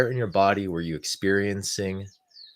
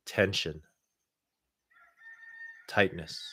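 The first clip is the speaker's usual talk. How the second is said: calm, unhurried guidance with long pauses. Acted. Leads a guided meditation.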